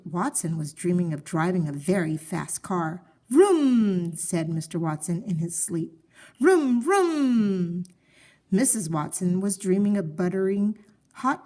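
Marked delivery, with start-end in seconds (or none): 3.3-4.2 revving engine vroom, loud and high; sleepy race car rev; car noise. Acted. Dreaming of driving fast car. 6.4-7.8 revving engine vroom, loud and high; sleepy race car rev; car noise. Acted. Dreaming of driving fast car.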